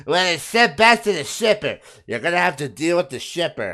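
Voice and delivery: mock an unhelpful courier rep; mocking UPS agent. UPS rep refusing to help a customer, mocking voice.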